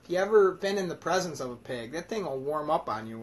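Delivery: ordinary talk, nothing marked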